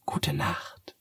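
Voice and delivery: soft whispered gute Nacht. Acted. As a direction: whisper goodnight to the child. Cat whispers goodnight. (geflüstert)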